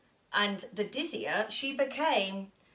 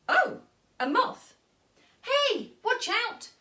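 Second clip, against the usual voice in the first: much higher, louder shriek; 14 semitones higher, 6 dB louder than usual. Acted. Molly shrieks at the astronaut about to step on her, high shriek. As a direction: yelp in alarm. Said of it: "shrieked Molly".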